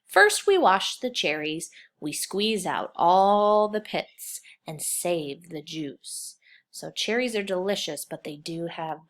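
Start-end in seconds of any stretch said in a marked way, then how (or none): none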